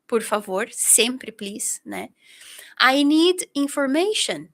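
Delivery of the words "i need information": slowly and clearly, stressing need; slow English demo. Shown as a demonstration. Teacher modelling the phrase for students, slow and clear. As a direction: say "I need information" slowly, leaning on "need". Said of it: "eu preciso informação".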